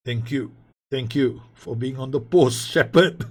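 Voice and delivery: loud, high, thrilled thanks; excited thanks. Real, not acted. Gamer cheering his keeper's save, excited.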